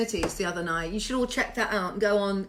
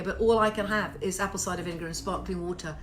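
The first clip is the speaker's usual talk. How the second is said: usual speaking voice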